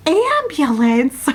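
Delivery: high, stretched-out incredulous question; alarmed disbelief. Acted. Upset girlfriend hearing about the ambulance, high alarmed disbelief. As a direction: echo it high and slow, rising in shock.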